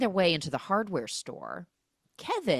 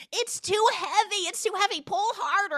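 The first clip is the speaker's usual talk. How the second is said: very high, strained squeal; high-pitched mock struggle; mock straining; 4 dB louder than usual. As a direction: act out panicked heaving on a heavy load. Acting out struggle with garage door.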